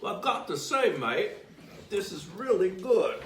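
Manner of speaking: light mock Australian while laughing; Australian accent. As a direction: slip into Aussie to praise the baker. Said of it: "I don't have an Australian accent".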